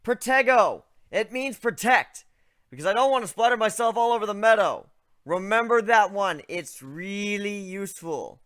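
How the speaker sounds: loud, high, carrying shout; shouting instructions to Ivan far away; yelling across. A narrator's reading. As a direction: project it over the meadow. Still yelling across the distance.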